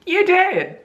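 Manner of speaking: high, bright, forced enthusiasm; fake cheerful. Congratulating her sister while hiding the hurt, fake cheer. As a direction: paste on a happy voice over the hurt. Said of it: "in my composure, I kept it".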